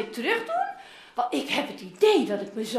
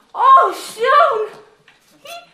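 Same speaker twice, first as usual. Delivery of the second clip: joyful cry; 9 semitones higher, 13 dB louder, much slower than usual. Acted. Father sees returning son.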